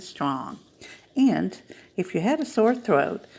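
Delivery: lively storybook reading, voices swinging high; animated picture-book reading for a children's story time. Acted. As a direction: read it like a bedtime book for little ones.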